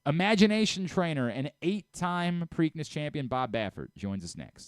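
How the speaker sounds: announcer-style show tease; host teasing the next segment, announcer style; show promo. Acted. Host station promo read.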